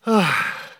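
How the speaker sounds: heavy, audible breath out; big puffed-out sigh; loud sigh. Pretend. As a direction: sigh loudly as if taking a breather. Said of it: "taking a bit of a break".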